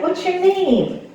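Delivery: put-on high, slow little boy question; son's voice. Son asking another kid his name, high slow kid voice. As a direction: ask it like a shy small kid. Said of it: "he's like".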